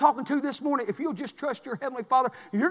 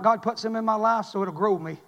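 usual speaking voice

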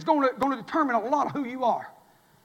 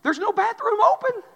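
higher, louder exasperation; exasperated thought; 10 semitones higher, 6 dB louder than usual. Retold or imitated. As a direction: burst out in frustration.

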